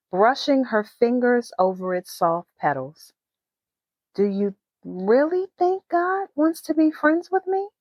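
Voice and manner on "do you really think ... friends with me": usual speaking voice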